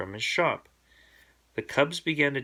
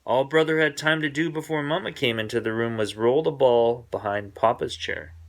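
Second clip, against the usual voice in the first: ordinary delivery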